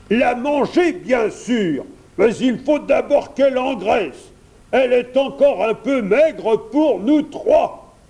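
loud, high dragon voice; greedy dragon. A narrator's reading. Dragon tells prince of eating the princess, greedy. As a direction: state your meal plan with forceful relish.

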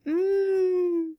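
high delighted mmm; pleased hum. Acted. Goldilocks tasting the perfect soup, high pleased hum. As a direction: savour it with a happy hum.

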